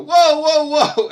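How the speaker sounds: wife echoing his outrage, mock shouting; mock shout back. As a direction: yelp it back comically. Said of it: "then she'd go".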